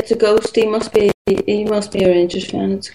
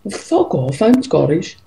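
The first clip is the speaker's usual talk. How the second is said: partner's comeback, put into Northern Irish; extremely Northern Irish accent; 4 semitones lower, slower than usual. Retold or imitated. Co-host retelling partner's answer to "you Englishman", Northern Irish accent. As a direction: retort in imitated Northern Irish. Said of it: "I say that in an extremely Northern Irish accent".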